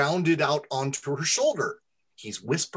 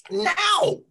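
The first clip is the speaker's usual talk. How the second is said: very high-pitched goblin demand; insistent; 4 dB louder than usual. A narrator's reading. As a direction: squeal it out as an impatient little goblin. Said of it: "Spittle insisted".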